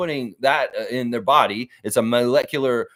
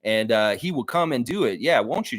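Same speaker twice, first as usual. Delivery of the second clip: normal talking voice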